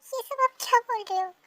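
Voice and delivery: sharp rising protest; angry. Acted. Daughter demanding answers from her father, angry. Girl angrily confronts father.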